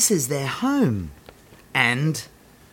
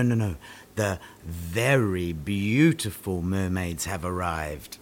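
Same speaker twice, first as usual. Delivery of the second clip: normal talking voice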